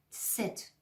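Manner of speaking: ست with a very quick t before s, "tset"; quick initial t. Shown as a demonstration. Teacher demonstrating German Z, ست with quick t in front. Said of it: "ت رو باید خیلی سریع تلفظ کنید".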